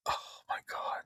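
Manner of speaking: whispered under the breath; hushed exclamation. Real, not acted. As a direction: drop to an unvoiced whisper. Awed aside about the whole scene.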